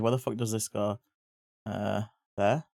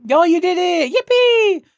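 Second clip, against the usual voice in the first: much higher and louder squeal of joy; excited cheer; 7 dB louder than usual. Real, not acted. Streamer cheers a viewer who did it, high excited squeal. Celebrating a chat member.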